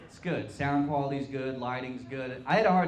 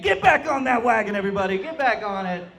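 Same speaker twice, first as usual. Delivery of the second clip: higher, louder mock pep shout; rally the crowd like a coach; hammed-up cheer to the audience; mock rallying cry; 7 semitones higher, 7 dB louder than usual. Mock urging crowd to relapse.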